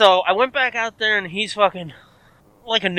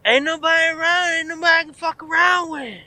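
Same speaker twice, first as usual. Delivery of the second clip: high, wailing mock complaint; rambling neighbour; 9 semitones higher than usual. Neighbour complaining to the teller in the yard, high mock wail.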